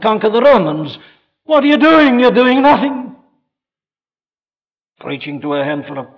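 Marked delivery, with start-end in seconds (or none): 0.0-3.2 high, loud scornful jeer; mocking taunt. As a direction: throw the challenge like a heckler. Mocking critics' voice in story.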